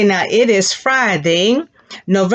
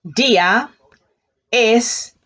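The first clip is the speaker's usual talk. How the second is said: one word at a time with pauses; word-by-word drill; 3 semitones higher, slower than usual. Shown as a demonstration. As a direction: split it into separate words for the class. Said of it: "Say that again".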